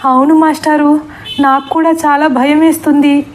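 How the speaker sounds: scared. Acted. Sarala madam tells the master she is scared, mildly afraid. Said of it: "బెదిరిపోతూ".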